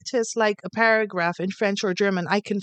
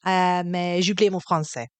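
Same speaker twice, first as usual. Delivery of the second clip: lower, unhurried French, like a tired tourist; French accent; 4 semitones lower than usual. Shown as a demonstration. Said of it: "Do French".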